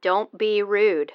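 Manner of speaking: slow stern reprimand. Acted. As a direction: scold the caller, slowly. Scolding caller.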